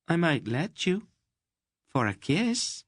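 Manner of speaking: Mady teasing Garion for a kiss. Acted. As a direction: bargain for a kiss, coyly. Narrator voices Mady.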